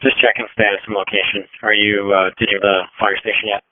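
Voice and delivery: ordinary talk, nothing marked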